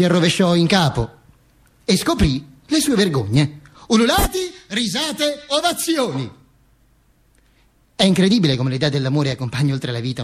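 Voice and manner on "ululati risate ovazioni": much higher excited listing; rising frenzy. Acted. Narrator evokes the howling crowd, high and frenzied. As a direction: build up the crowd's roar.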